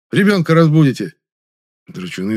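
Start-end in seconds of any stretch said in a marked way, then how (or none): 0.1-1.1 loud, high shout. A narrator's reading. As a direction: yell to stop the fight. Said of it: "рявкнул я". (громкий крик)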